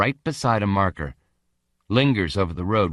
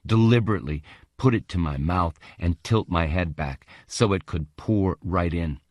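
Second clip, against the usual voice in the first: plain reading voice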